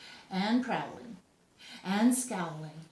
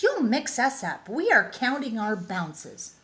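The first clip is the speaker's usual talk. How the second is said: higher, louder rabbit voice; bouncy rabbits complaining; 4 semitones higher, 7 dB louder than usual. A narrator's reading. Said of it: "said the rabbits".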